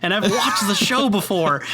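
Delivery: normal talking voice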